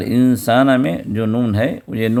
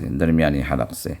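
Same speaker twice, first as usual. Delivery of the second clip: normal talking voice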